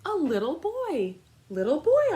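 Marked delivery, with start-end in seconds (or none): 1.5-2.1 high, loud surprised exclaim; surprised to find a boy at the door. Acted. Amu surprised at the door, higher and louder. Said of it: "Amu exclaimed".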